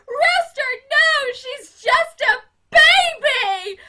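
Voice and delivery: high, loud and slow wail; desperate pleading scream. Retold or imitated. Miss Hannigan running after her brother, screaming high and loud. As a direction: beg him to stop with everything. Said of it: "Miss Hannigan's running after him like".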